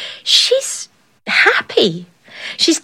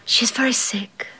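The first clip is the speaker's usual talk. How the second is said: whisper; 6 dB quieter than usual. A narrator's reading. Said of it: "maid whispering plot".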